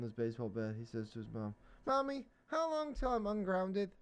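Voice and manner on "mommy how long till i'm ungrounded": put-on high child pleading with mom; a small boy asking when he's free; little boy voice. Billy asks his mom.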